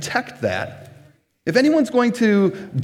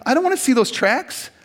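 mock petty anger; 7 semitones higher, faster than usual. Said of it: "and you might get angry. You're like".

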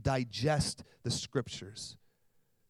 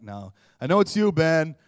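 loud knowing retort; mock-accusing shout; busted call-out; 10 dB louder than usual. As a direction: catch your friend out. Calling out the prank.

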